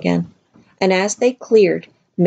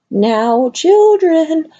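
very high, loud, slow eerie warning; strange stern witch; 11 semitones higher, 5 dB louder, slower than usual. Acted. Missus Witch warns the children not to be frightened, very high and slow. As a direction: boom it out like an otherworldly oracle.

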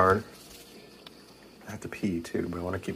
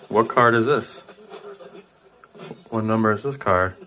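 calling out; 4 semitones higher, 7 dB louder than usual. Real, not acted. Calling out questions to spirits.

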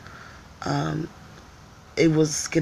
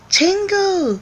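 much higher, louder sing-song greeting; sweet mock greeting; 5 dB louder than usual. Greeting the fighter guy in cutesy Korean. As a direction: call out cute and bright. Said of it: "I was like".

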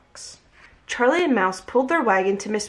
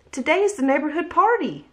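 light shout; 7 semitones higher than usual. A narrator's reading. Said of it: "shouted Mouse".

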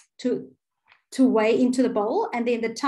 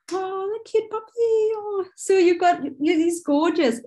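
cooing; 7 semitones higher, 3 dB louder than usual. Real, not acted. Cooing over a puppy on screen.

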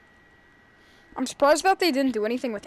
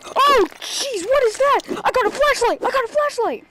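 high, loud panicked cries; panicked yelling; 10 semitones higher, 7 dB louder than usual. Real, not acted. Gamer yelling in panic at a horror monster. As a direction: yelp it out in sheer fright.

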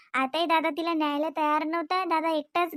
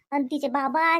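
normal talking voice

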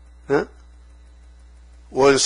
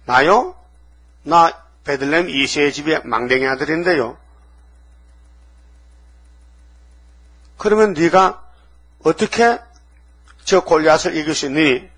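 voiced dialogue; 2 semitones higher than usual. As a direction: act out king and boy questioning each other. Dialogue of Saul and David.

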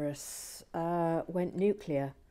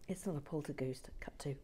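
ordinary talk, nothing marked

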